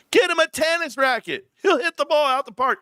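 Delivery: high-pitched, hyped-up exclamation; amused hype, pitch leaping up; excited joking. Real, not acted. Hyping with a joke, laughing.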